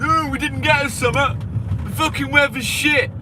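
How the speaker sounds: high, whiny mimic of complainers; hammed-up whinge about the weather; mock whining moaner. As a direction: moan it like a sulky Brit. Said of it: "everyone will be crying again".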